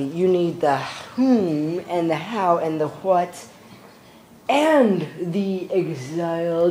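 slow, dragged-out delivery; too slow. Real, not acted. Explaining his theory to viewers, slow and drawn out. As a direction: stretch each word out deliberately. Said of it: "I'm speaking too slow".

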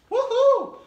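high loud whoop; cheer. Real, not acted. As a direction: let out a big high whoop. Cheering at show start.

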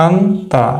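আন্তা said slowly, each sound clear, falling at the end; teacher sounding out আন্তা; pronunciation demo. Shown as a demonstration. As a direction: model the word আন্তা carefully for the class. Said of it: "কোনটা উচ্চারণ করব?".

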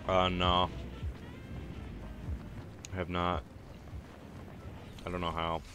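normal talking voice

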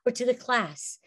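usual speaking voice

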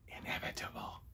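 hushed, voiceless whisper. A narrator's reading. Remembering Ruth's one word in the dark, whispered. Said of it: "one word whispered in the dark".

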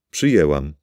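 usual speaking voice

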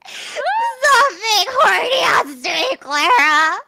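slow, wailed plea; exasperated scolding. Real, not acted. Scolding Clara on stream, exasperated wail. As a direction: draw it out in high exasperation.

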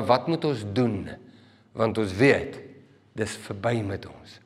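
soft, low, slow question sinking away; cut to the heart. Acted. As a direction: say it like hope is gone. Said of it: "is diep getref in hulle harte".

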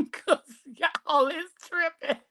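laughing through the words; giggling while talking. Real, not acted. Laughing at chat.

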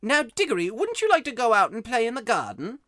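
put-on high, loud aunt shooing a child; Aunt Letty. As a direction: send the boy off in a high voice. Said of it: "She always shuts him up".

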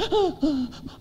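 mock gasping sobs, high and soft; imitated sobbing. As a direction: act out someone crying on a video call. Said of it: "Ada orang nangis". (tiruan isak tangis tersengal)

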